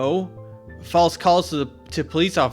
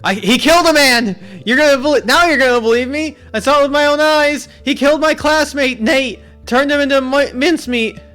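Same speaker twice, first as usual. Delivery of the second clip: high, loud, frantic yelling of a terrified witness; panicked screaming; 13 semitones higher, 10 dB louder than usual. Acted. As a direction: scream it like you just saw a murder. Max screams about the murder.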